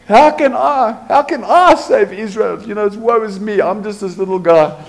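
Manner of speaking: high, snivelling self-pity; mock-pathetic little man; whiny woe is me. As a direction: whine like the smallest guy in the room. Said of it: "he said to the angel".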